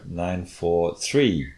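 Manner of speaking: "nine four three" very slowly, pitch rising on "three"; a slow, deliberate accent lifting "three"; rising pitch on third. Shown as a demonstration. Correcting the demo of the accent condition. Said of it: "with a rising pitch on that third one".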